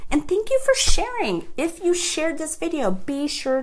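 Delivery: high, loud delighted thank-you; excited thanks. Real, not acted. Host gushing thanks to viewers who shared the video. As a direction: gush gratefully at the viewers.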